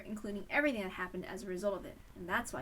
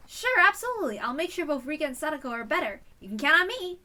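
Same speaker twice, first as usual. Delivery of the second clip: eager; 5 semitones higher, 8 dB louder than usual. Acted. Eager reassurance.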